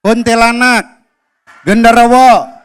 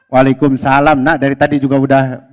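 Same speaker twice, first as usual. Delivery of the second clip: lower, calm fatherly reply; 10 semitones lower than usual. Acted. Father answering his son Abdi, low and calm. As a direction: answer as a gentle dad.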